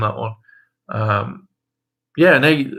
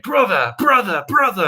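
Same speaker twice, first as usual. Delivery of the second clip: higher, whiny repeated brother; rising mock as it repeats; mocking Nate; 9 semitones higher, 4 dB louder than usual. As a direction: parody of Nate calling brother. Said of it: "he keeps referring to Steel and everything like".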